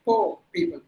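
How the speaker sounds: "Poor" as paw; no r in "Poor people"; silent R. Shown as a demonstration. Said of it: "it's not the right way".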